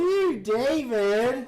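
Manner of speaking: high, drawn-out disgusted ew; whiny valley-girl ew; Alexis impression. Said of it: "She sounded just like her".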